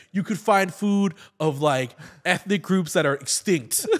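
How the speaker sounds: high, loud excited comic voice; hammed-up retelling of a comedy bit; quoting a friend's bit. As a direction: pitch it up like telling a punchline. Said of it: "a great comedy bit where he's like".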